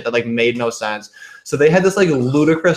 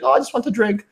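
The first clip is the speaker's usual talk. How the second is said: mock scared Russian girl; 12 semitones higher, faster than usual. Mocking the actress who played a scared Russian girl, high and giggly. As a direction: squeak it out like a pretend-helpless girl. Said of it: "She's like".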